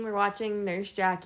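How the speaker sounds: normal talking voice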